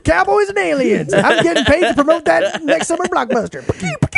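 put-on high, cackling old prospector; cackly wheezy old timer. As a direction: wheeze it out like a grizzled miner. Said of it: "Hey, old Prospector".

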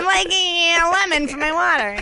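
high, whiny annoying-customer voice; annoying man. Retold or imitated. Guest imitating an annoying man for the hosts, high and whiny. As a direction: pester like a fussy customer asking for lemon. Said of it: "Lovett's the annoying man".

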